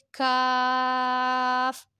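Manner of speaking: "کاف" with a light k made further forward, held long; thin k. Shown as a demonstration. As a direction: stretch "کاف" with an open vowel. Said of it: "حلق سے تھوڑا سا آگے سے".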